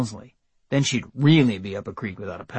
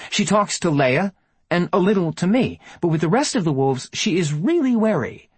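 firm, higher, distracting chatter; 6 semitones higher than usual. A narrator's reading. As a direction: keep talking, firmly, to distract. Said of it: "she said firmly".

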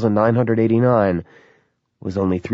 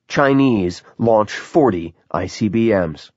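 headline reading; 3 semitones higher than usual. A narrator's reading. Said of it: "The headlines were stark and huge".

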